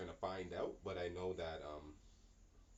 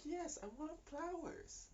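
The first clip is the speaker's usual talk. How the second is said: much higher, slow and drawn out; demanding partner; slower than usual. Retold or imitated. Partner who wants flowers, high and drawn out. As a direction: stretch it out like a fed-up girlfriend.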